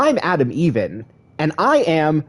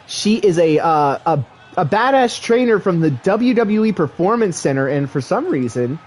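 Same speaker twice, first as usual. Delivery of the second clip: excited wrestling announcer; play-by-play commentary; 6 semitones higher than usual. Acted. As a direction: call the match like a ringside commentator.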